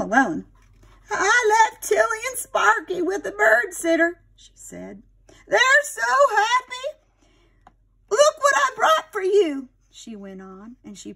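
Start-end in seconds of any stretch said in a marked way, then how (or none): 1.1-4.2 cheerful aunt. Acted. Aunt Sarah arrives alone. 5.5-9.7 happy aunt. Acted. Aunt Sarah showing the family her photo, high and beaming.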